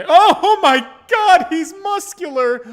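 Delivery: high, loud gasp of admiration; thrilled outburst. Real, not acted. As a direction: blurt it out, bowled over by what you see. Thrilled reaction to a muscular character.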